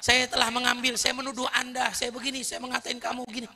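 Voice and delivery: pleading apology. Retold or imitated. Ashamed man begging Ali's forgiveness, pleading. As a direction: grovel and beg forgiveness.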